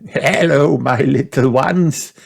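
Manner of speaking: put-on a granny delighted to see the kids; Granny greeting. A narrator's reading. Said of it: "answered Granny Pig".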